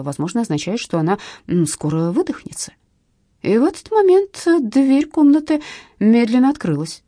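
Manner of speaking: usual speaking voice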